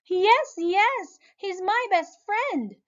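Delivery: high, loud joyful shout; girl runs to bear, shouting happily. Acted. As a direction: shout with joy. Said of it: "shouted Lisa".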